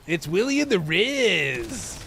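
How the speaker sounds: slow, swooping morning-show host; radio DJ bit. Acted. Streamer reviving an old morning radio bit, hammy DJ voice. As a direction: stretch it out high like a hammy DJ. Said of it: "I used to do this bit".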